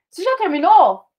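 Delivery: pergunta mais aguda e alta; pergunta encenada. Pretend. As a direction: finja alguém apressando. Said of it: "Se alguém fala assim". (alguém pergunta se já terminou, tom de pergunta encenada)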